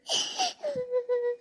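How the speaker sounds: high muffled sob; a soft, high, crumpling sob. Acted. Aunt breaking down over her sister's last wish, a high muffled sob.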